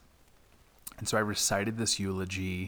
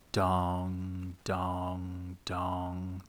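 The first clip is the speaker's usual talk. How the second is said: bell imitation; much slower than usual. Narrator imitating the funeral bell music, slow tolling. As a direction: toll each dong like a solemn bell.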